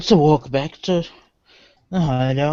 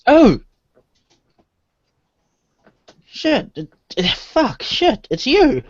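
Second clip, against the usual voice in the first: startled; 11 semitones higher, 4 dB louder, slower than usual. Acted. Player startled by masked rival.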